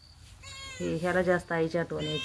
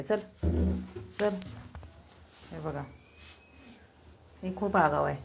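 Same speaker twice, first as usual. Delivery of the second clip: normal talking voice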